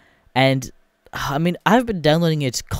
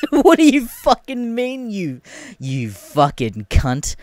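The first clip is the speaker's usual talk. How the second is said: high, loud, drawn-out indignation breaking with laughter; incredulous outrage; 7 semitones higher, 4 dB louder, slower than usual. Retold or imitated. Buyer swearing at the game case, incredulous outrage. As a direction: spit out each word slowly, half laughing.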